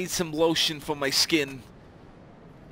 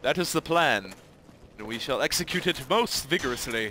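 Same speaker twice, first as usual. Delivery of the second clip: ordinary talk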